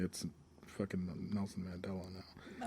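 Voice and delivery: hushed aside under breath; whispered aside. Real, not acted. Muttered aside.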